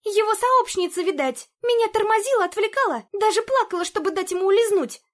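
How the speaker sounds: high, loud breathless accusing; too much caffeine. A narrator's reading. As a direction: rattle it off, shrill with suspicion. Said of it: "она затараторила на одном дыхании".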